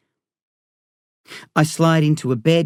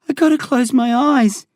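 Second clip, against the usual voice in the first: high, fading plea; weak frightened voice; exhausted scared boy; 10 semitones higher than usual. A narrator's reading.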